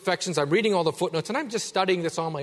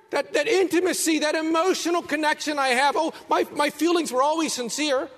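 high, loud, strained begging; desperate pleading; 13 semitones higher, 6 dB louder than usual. Acted. False believers pleading with the Lord, loud desperate cry.

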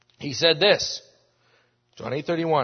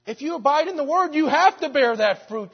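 much higher and louder, ringing out; preaching at full force; 12 semitones higher, 11 dB louder than usual. Acted. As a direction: proclaim it to the back row. Preacher repeats the promise louder.

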